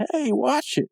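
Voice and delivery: high-pitched mock protest; indignant call. Knocked-over guy shaking his fist at skateboarders, indignant call. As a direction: call after them in cartoon indignation.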